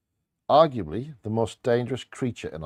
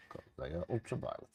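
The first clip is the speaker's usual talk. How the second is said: whispered aside; 15 dB quieter, much faster than usual. Real, not acted. Muttered correction aside.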